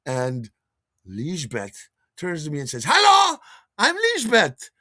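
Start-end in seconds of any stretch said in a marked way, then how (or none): 2.9-4.7 put-on very high, loud German-accented woman; Lisbeth's accent. German woman introducing herself at a dance, high accented voice. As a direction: chirp a big hello in a German accent. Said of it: "with her accent".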